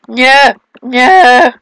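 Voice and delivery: dismayed shouts. Real, not acted. Player missing in the game, dismayed shouts. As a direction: protest loudly, twice over.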